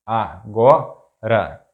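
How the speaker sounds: agora slowly split, a - go - ra; syllable by syllable. Shown as a demonstration. Teacher breaking down an example word, agora slow in syllables.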